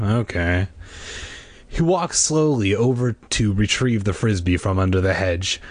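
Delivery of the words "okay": without enthusiasm. A narrator's reading. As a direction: agree with no enthusiasm at all. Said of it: "Casey said without enthusiasm".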